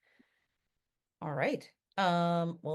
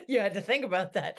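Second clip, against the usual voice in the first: laughing; 4 semitones higher, 5 dB louder than usual. Real, not acted. Teasing a hesitant voter.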